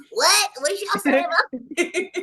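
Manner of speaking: high, laughing kid cartoon voice; Riley Freeman voice. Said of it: "His little Riley Freeman voice".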